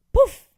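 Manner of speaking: sharp, high, loud poof sound; magic poof. Acted. Narrator voicing a magic arrival, bright poof. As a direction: pop it like a sudden burst of magic.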